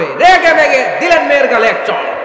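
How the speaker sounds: high, loud, drawn-out climax; impassioned preacher's outburst; dramatic shouted narration. A narrator's reading. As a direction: shout the story's turning point, stretching it.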